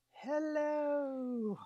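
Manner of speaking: a goofy high-pitched greeting; silly high hello. Pretend. Co-host clowning to his partner, a high goofy hello. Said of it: "should I try saying this".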